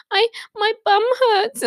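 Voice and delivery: put-on high, slow little-kid whimper; whiny child. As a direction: plead like a hurt child. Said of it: "I said".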